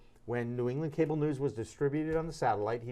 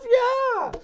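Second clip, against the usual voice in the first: imitated Steinbrenner; 9 dB louder than usual. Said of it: "He goes".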